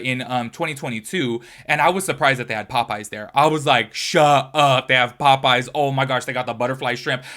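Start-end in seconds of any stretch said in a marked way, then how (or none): 3.9-7.2 retold excitement. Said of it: "I was so excited".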